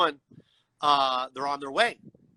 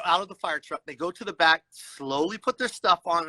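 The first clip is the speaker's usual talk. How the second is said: usual speaking voice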